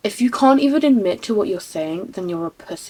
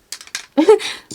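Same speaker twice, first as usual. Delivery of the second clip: high laugh; 11 semitones higher, 7 dB louder than usual. Real, not acted. Laughing at own joke.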